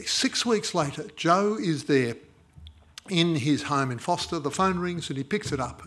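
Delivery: ordinary talk, nothing marked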